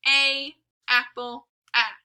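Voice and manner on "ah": ordinary delivery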